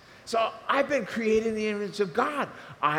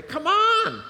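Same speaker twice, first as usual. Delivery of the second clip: much higher and louder, "Come on" through a laugh; 14 semitones higher, 7 dB louder than usual. Real, not acted. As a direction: cheer them out of doubt with a laughing "Come on". Preacher laughs at doubting feelings.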